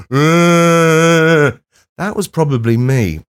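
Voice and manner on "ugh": long loud sustained "Ugh", higher; long groan. Retold or imitated. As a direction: groan out into the rain, despairing. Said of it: "a man standing ... going".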